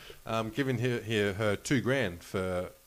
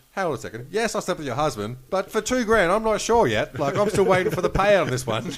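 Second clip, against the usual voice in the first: high, louder hammed-up airhead reasoning; mock waitress voice; 9 semitones higher, 8 dB louder than usual. Host imitating the waitress arguing over her payout, high mock voice. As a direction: play her as shamelessly oblivious. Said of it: "But just the fact that".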